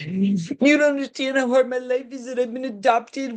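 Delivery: put-on teen guilt-tripping his dad, mildly whiny. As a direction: whine it like a guilt-tripping adopted son.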